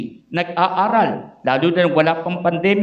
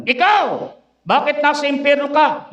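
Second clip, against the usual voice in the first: Satan interrogating; 6 semitones higher than usual. Acted. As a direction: bark the question like hell's gatekeeper. Satan questions next sinner.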